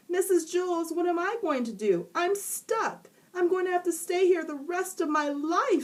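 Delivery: put-on high, overdramatic boy voice; whiny stuck boy. A narrator's reading. Stuck boy complaining to teacher, high whine. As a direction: wail it like a doomed kid. Jason's voice in story.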